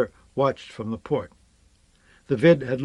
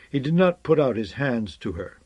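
plain reading voice

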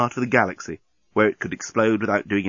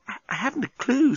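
high, stammering woman's voice; flustered Nettie; 12 semitones higher than usual. Acted. Nettie cornered by the engineer, high flustered reply.

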